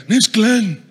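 curt, put-on tough-guy voice; intimidating mobster. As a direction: imitate the big intimidating guy. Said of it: "this is exactly how he sounds".

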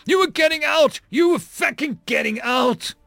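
high, loud shout of grief and rage; anguished shouting. Acted. Marine yells at dead friend who was leaving, anguished shout. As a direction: rage at him, voice pushed way up.

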